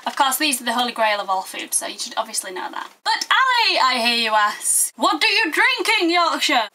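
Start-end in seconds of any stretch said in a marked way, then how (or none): 3.0-3.8 high, mock calling-out voice; pretend shout. Anonymous viewer shouting through the internet, pretend shout. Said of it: "anonymous person pretending to shout through the internet". 4.9-6.7 raised-pitch mock shouted question; pretend shout. Anonymous viewer asking through the internet, pretend shout. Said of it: "anonymous person pretending to shout through the internet".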